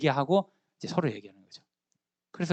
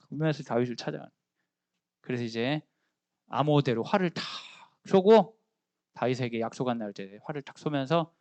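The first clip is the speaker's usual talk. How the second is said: normal talking voice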